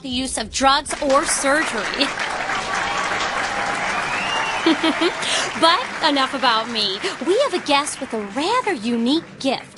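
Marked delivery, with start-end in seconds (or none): 2.1-5.5 an airy little laugh; breathy self-amused laugh. Acted. TV host laughing at her own beauty plug, airy laugh. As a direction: laugh at your own joke.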